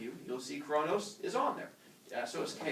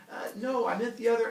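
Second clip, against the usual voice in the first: nervous backpedal; 8 semitones higher, 5 dB louder than usual. Retold or imitated. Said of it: "You're like".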